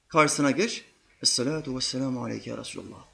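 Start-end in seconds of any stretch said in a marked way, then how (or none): 1.2-3.1 hushed, lower respectful greeting; soft low voice. Shown as a demonstration. Preacher showing the proper greeting to the Prophet, soft and low. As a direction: greet him softly and reverently. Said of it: "Kısık bir sesle, yumuşak bir sesle bunu söyle".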